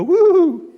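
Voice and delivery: high, loud woo; imitated Doughboy hoot. Boxer imitates Pillsbury Doughboy's expected cry, high hoot. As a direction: squeal a cartoonish woo.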